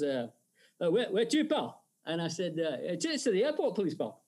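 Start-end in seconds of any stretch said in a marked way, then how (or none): 0.8-1.8 high Glaswegian cabbie; Glasgow cab driver. Acted. Cab driver asking his passenger, Glaswegian accent. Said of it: "and the driver goes". 3.0-4.2 high, lilting Scottish opening; lilting Edinburgh accent. Acted. Passenger answering the cabbie, lilting Edinburgh accent. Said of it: "I'd got my lilting Edinburgh accent".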